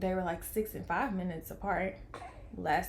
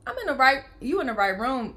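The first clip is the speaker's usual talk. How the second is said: louder, emphatic declaration; proclaiming it with conviction; exclaiming; 5 semitones higher, 7 dB louder than usual. Real, not acted. Mother in the delivery room, louder and emphatic. Overwhelmed by the scripture sign.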